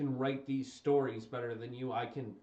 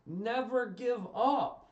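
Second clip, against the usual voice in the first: slow, loud and higher, each word hit; motto punched out; 8 semitones higher, 6 dB louder, slower than usual. Real, not acted. Fan reciting Cena's catchphrase, slow and forceful. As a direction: land the motto like a rallying cry.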